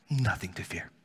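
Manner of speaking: whispered repeat of the reassurance. Shown as a demonstration. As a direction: hush it like a secret comfort. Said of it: "You have nothing to fear".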